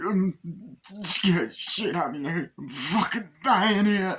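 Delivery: high-pitched plea, drawn out at the end; acted panic. Wounded man pleading with Larry, acted panic. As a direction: play a dying man panicking to his partner. Acting dying man's panic.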